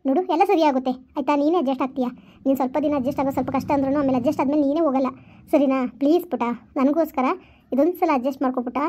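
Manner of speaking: put-on calm a crying child gently; mother consoling her weeping daughter; soothing mother.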